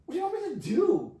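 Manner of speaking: high, loud, incredulous question; baffled protest. Retold or imitated. Man told to heal a stranger asks what is expected, baffled protest.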